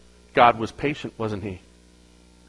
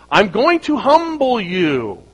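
higher, slow, weighty warning; a hint of God warning the proud king; mild divine threat; 6 semitones higher, slower than usual. God warning Nebuchadnezzar, slow and weighty. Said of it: "Let's change that word".

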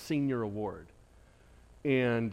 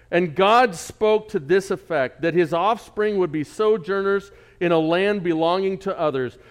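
slow, louder, solemn reading of the verse; scripture reading; 3 semitones higher, 9 dB louder than usual. Acted. Pastor reads scripture to the church, slow and solemn. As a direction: read it out like holy writ to the congregation.

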